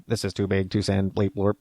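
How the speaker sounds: mock machine error message; computer voice. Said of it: "That's my computer voice".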